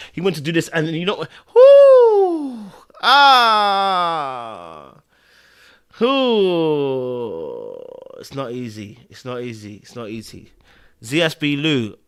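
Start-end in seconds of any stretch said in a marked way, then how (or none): 1.5-7.8 drawn-out cries of emotional release; overwhelmed exhale. Real, not acted. Viewer composing himself after the scene, long falling whoos. 8.2-10.5 low, very soft murmur; subdued sympathy. Real, not acted. Viewer moved by a bereavement scene, quiet low sympathy. Consoling after a sad scene.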